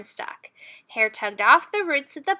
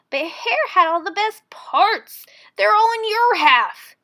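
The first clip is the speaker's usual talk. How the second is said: louder, rising complaint; a bear realizing he's been had; aggrieved protest over the crops; indignant Bear; 7 semitones higher, 8 dB louder than usual. A narrator's reading. Bear's voice, upset.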